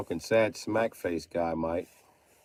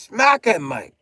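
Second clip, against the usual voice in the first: much higher and louder yell; loud shout; 11 semitones higher, 13 dB louder, slower than usual. Real, not acted. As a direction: yell it out, egging him on. Urging Mike to hit Kyle.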